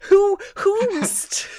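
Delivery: a hammed-up scandalized noble; mock aghast. Parents aghast at Miria.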